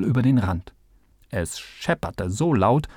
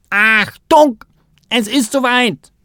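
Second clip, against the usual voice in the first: hoher, lauter Alarmruf; Schreien aus vollem Hals; 11 semitones higher, 9 dB louder than usual. A narrator's reading. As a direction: jemanden mit einem Schrei aufschrecken. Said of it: "schrie Kalle aus vollem Hals". (aus vollem Hals schreien)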